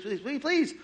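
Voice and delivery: pleading climbs high on the last please; desperate rising please; high desperate begging. Acted. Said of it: "begging repeated".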